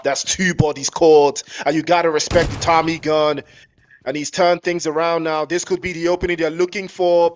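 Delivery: loud, rising esports call as the fight turns; live play-by-play. Real, not acted. Caster calling the fight to stream viewers, loud excited play-by-play. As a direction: ride the action like a caster who can't sit still.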